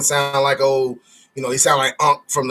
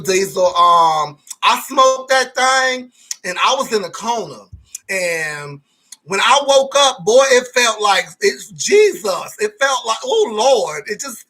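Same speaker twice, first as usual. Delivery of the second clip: mimicking a caller; 5 semitones higher than usual. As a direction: act out the caller high and dazed. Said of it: "That's what he told me when he called me".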